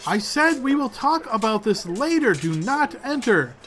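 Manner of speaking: sharp, high, loud order to go away; angry shout. Acted. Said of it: "her face goes from tired to angry".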